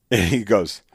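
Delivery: normal talking voice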